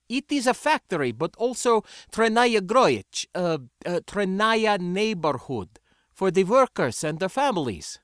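put-on high-pitched, accented insistence; exasperated foreign boy. Boy explaining the worker neighborhood to a visitor, exasperated. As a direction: search for the word in halting foreign English.

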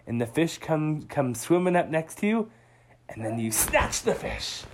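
dad whispering the sneaky trick to Otto; sneaky hushed dad. Acted. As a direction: lean in and share the secret. Dad shares secret trick.

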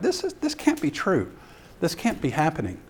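high, softly voiced mock disbelief; amazed disbelief. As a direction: start high, then settle, as if unable to believe it. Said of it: "to his amazement".

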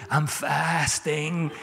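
very slow, drawn-out woe-is-me; hammed-up weary complaint; mock suffering. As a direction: stretch it out like a feeble martyr. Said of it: "you just about crawl into a room".

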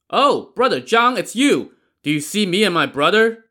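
high loud friendly call. A narrator's reading. Gang leader hailing the boatman, calling out. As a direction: hail him across the water.

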